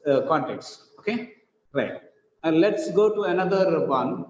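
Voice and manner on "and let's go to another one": ordinary talk, nothing marked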